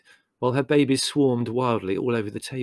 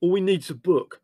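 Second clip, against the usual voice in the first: raised, roaring voice of command; Leslie; 7 semitones higher than usual. Acted. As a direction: roar it out over the panic. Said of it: "roared Leslie".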